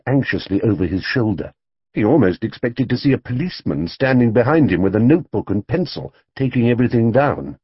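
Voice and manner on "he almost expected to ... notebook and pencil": ordinary delivery, nothing marked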